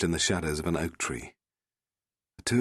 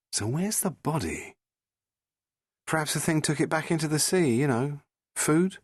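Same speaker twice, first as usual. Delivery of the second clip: lightly higher character voice; voiced characters; 9 semitones higher than usual. Rose and the Doctor on the cliff, lightly voiced. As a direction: lift the voice for the dialogue.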